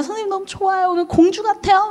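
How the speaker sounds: put-on child's voice. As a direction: gush like an excited preschooler. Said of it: "막 아이들이 막 달려와요". (아이 흉내 내는 높은 목소리)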